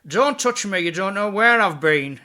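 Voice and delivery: high, loud, slow creepy teacher voice; retelling the strange teacher who hated being touched. Retold or imitated. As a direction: squeal it like a touchy odd teacher. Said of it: "And he'd go".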